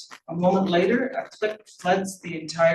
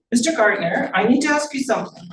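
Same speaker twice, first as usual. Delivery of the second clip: high, louder, brisk principal voice; 6 semitones higher, 7 dB louder than usual. A narrator's reading. Principal questions the janitor outside the closet, brisk and high. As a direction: call on the janitor as a brisk official.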